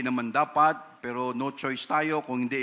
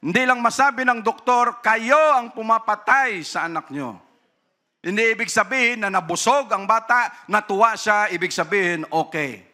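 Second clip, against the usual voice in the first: louder, higher impassioned preaching; forceful preaching; 8 semitones higher, 6 dB louder than usual. Real, not acted. Preacher warning parents, loud and impassioned. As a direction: drive the point home to the congregation.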